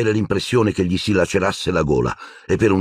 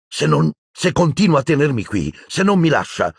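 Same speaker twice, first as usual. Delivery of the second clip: angry; 9 semitones higher than usual. A narrator's reading. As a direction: threaten and lose the words. Harry threatening Silente.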